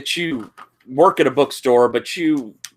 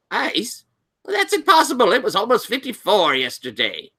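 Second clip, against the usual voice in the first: high, incredulous librarian; Doctor Pritchard; 11 semitones higher than usual. Acted. Librarian doubting a student's tale of ice, high and incredulous. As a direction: question it in disbelief, voice pitched up. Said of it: "Doctor Pritchard going".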